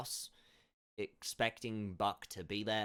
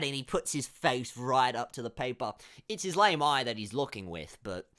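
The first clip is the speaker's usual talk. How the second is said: ordinary talk, nothing marked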